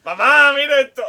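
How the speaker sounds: put-on high, loud comic kid's call through laughter; showing-off child. Dolphin showing off to its human mother, childish boasting. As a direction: crow for mum's attention, hammed up.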